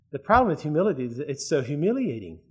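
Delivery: normal talking voice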